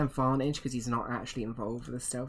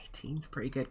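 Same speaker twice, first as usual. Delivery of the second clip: whispered aside; 7 dB quieter than usual. Real, not acted. GM aside under breath.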